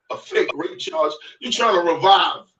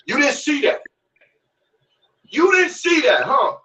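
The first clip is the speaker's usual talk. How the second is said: shouting higher and higher; rising shout; 8 semitones higher than usual. Real, not acted. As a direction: yell the question at him.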